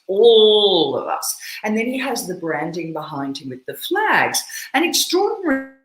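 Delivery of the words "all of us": "all" stretched long and loud; weighting on ALL. Shown as a demonstration. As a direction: lean hard and slowly on "all". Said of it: "Other weighting that he uses".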